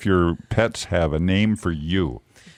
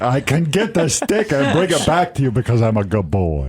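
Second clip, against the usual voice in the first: slightly raised goofy dog voice with a Southern-sounding twang; dog impression. Shown as a demonstration. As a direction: talk as an eager, simple good dog. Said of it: "do your impression there".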